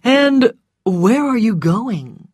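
unhurried civil query; polite inquiry. A narrator's reading. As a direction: ask with measured, exaggerated politeness. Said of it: "he asked politely".

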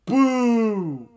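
very high, loud, sustained boo; hammed-up drawn-out jeer. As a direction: heckle at full volume. Jeering a wrestler.

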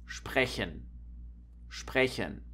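soft-spoken sprechen with SP as shp. Shown as a demonstration. German teacher giving an example, shp in sprechen. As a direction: begin sprechen with sh plus P. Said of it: "this combination is pronounced S C H, P".

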